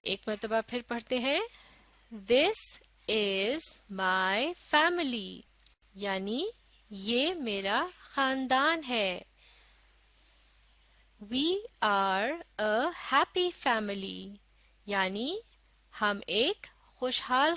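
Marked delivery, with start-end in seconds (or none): 2.1-5.4 very slow, word by word; slow model reading. Shown as a demonstration. Second model reading for learners. 11.2-14.4 very slow, word by word; slow model reading. Shown as a demonstration. Second model reading for learners.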